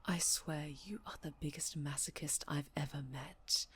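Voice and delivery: low, soft whispered jab; exasperated whisper. Acted. Captor muttering at a defiant prisoner, whispered. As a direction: mutter it under your breath in disgust.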